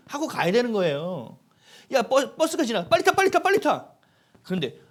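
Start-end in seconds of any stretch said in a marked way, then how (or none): 1.9-3.9 high, loud rapid-fire urging; urgent call to hurry. Acted. Preacher acts out rushing someone onto a departing bus, urgent.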